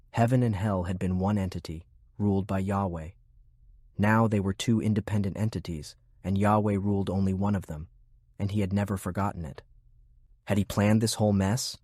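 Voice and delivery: usual speaking voice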